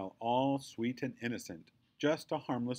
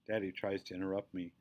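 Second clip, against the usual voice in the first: usual speaking voice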